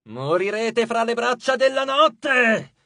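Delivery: high, slow shouted snarl; snarled threat. A narrator's reading. Goddess Aklis dooms the two demigods, snarling. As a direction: growl a curse rising to a shout. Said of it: "ringhiò Aklis".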